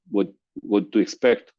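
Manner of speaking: ordinary talk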